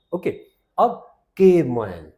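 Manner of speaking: usual speaking voice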